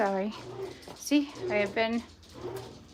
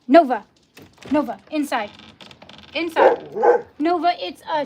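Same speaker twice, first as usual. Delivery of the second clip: loud, firm commands to a dog; calling the dog; 4 semitones higher, 14 dB louder than usual. Real, not acted. Owner to barking dog outside, shouted command. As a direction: order the dog in at full voice.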